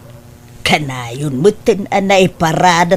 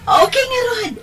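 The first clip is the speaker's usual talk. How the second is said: excited shout. Acted. Cheerful goodbye on the phone.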